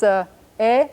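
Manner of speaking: normal talking voice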